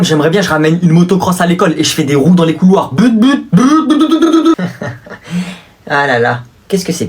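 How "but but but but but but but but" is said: rising, very high engine revving; motorbike noise. Acted. Pupil imitating his motocross, rising ever higher. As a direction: rev it up higher and higher.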